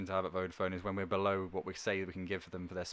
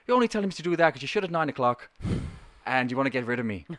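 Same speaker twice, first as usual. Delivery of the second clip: put-on Irish accent; 2 semitones higher, 7 dB louder, faster than usual. Caller from Ireland griping in an Irish accent. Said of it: "That's a great Irish voice".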